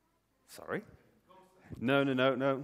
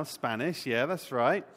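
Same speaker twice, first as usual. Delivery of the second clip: normal talking voice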